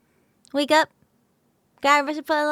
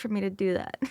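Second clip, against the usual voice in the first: ordinary talk, nothing marked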